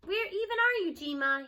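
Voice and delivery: put-on boy calling for grandma, uneasy; shouting. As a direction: call out into the dark woods. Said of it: "he shouts".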